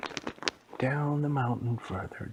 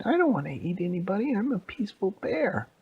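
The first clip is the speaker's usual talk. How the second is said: high, loud little bear voice; high, quick baby bear protest; 10 semitones higher, 5 dB louder than usual. Acted. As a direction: squeal it like a stubborn cub.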